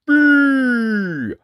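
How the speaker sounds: high, loud, drawn-out boom; huge storybook booming syllable; loud giant noise. A narrator's reading. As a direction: blast out the giant's first sound. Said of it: "There came a loud".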